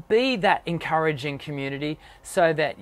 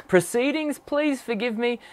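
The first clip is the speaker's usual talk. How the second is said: normal talking voice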